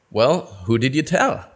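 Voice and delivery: normal talking voice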